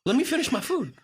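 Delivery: high-pitched comic protest; mock exasperated plea. Diner protests to waiter asking for a tip before food, mock exasperated. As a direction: plead in exaggerated disbelief.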